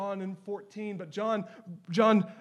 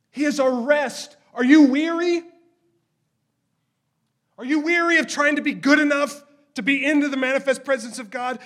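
loud, higher pleading preacher's cry; full-force preaching; 5 semitones higher, 11 dB louder than usual. Acted. Preacher calling out to weary listeners, loud and pleading.

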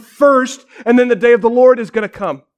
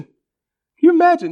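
incredulous; 9 semitones higher than usual. Real, not acted. Repeated question, second pitched up.

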